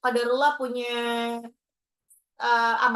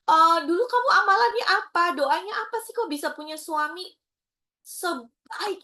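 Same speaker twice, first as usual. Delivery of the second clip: envious friends; 6 semitones higher, 4 dB louder than usual. Retold or imitated. Friends asking in envy.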